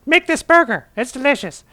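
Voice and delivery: squeaky high mock female voice; high falsetto Heather. Dubbing his cousin Heather's words in a mock high voice. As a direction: pipe it up high like a cartoon cousin. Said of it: "Heather says".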